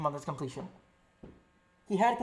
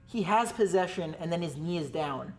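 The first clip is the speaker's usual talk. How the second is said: ordinary delivery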